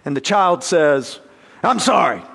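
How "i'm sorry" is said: a grudging, throwaway "I'm sorry"; a sulky child's token "I'm sorry"; half-hearted apology. Retold or imitated. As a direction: mumble "I'm sorry" like you don't mean it. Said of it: "half-hearted attempts".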